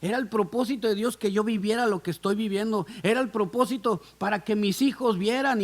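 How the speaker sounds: usual speaking voice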